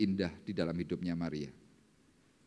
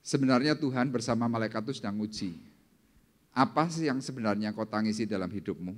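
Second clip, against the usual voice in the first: ordinary talk, nothing marked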